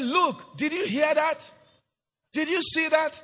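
high, thrilled exclamations; Jesus turning to the crowd, excited and high. Retold or imitated. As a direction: pitch it up, amazed and eager.

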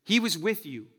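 high, loud, impassioned sermon; full-force preaching. Real, not acted. Preacher to congregation on suffering redeemed, full-force preaching.

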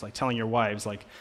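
usual speaking voice

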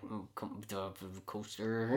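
very soft, halting, held-back mumble; seething housewife imitation. Housewife spots a drink off the coaster, imitated suppressed upset. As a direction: stammer it under your breath, drawing out the end. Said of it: "housewife just being like".

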